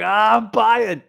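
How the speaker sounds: loud, high, grabby "Ah, I'm buying"; greedy eager buyer. Retold or imitated. Mocking hungry traders in a hot market, loud and eager. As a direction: blurt it out like a buy-happy trader. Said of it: "people are just like".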